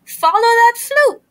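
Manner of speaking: high, loud chase cry; yelled. A narrator's reading. Three friends yelling after the floating flute, high and loud. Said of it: "yelled Fifi, Frog, and Fawn".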